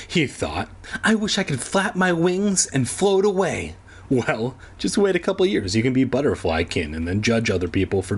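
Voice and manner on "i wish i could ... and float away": put-on wistful boy voice. Boy voiced in read story.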